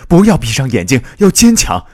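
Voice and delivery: high, loud pleading shout; urgent shout. A narrator's reading. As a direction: call out to keep someone awake. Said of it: "一个男青年喊道". (高声急切地呼喊)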